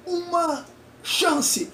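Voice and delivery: very high and very slow, "uma chance" stretched out stiffly word by word; mechanical examiner voice. Retold or imitated. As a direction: say "uma chance" like a robot weighing each word. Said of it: "bem mecânico".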